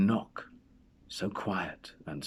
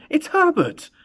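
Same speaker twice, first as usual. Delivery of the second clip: much higher, much louder frantic scream; 10 dB louder than usual. A narrator's reading. Mother screaming that her dead son is at the door. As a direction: shriek it out, desperate hope. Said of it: "she screamed".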